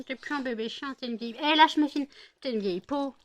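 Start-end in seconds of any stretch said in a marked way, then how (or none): 1.4-2.1 louder, higher, snapped order; mother ordering the dog off her daughter, loud; sharp command. Real, not acted. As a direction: bark it at the dog.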